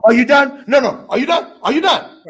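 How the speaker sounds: pushy, rising are-you-done; badgering someone to finish talking; impatient interrupting.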